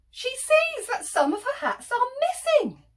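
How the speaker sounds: gasp, then high, loud alarm; shocked narration. A narrator's reading. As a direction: announce the loss in theatrical shock. Hetty discovers hats are missing.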